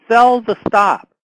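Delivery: ordinary delivery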